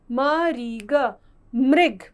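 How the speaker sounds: slow separate "म", "री", "ग", then joined "मृग"; spelling out. Shown as a demonstration. Teacher repeating the word मृग for learners, spelling out. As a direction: break "मृग" into sounds, then say it whole.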